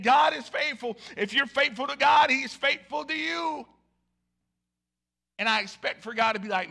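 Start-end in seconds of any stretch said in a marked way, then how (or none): 0.0-3.7 louder, higher declaration; preaching shout. Retold or imitated. Pastor proclaiming to the congregation, loud shout. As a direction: proclaim God is faithful at full voice. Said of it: "I'm sitting up here saying".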